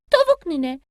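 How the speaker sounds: high, eager little chick; a small child calling at granny's door. Acted. Bili Bili greets granny hen at her door, high child voice.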